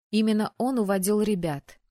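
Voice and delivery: ordinary delivery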